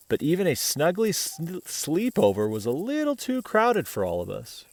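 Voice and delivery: put-on gentle read-aloud voice for children; picture book reading to kids; storybook narration. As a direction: tell it like a bedtime story.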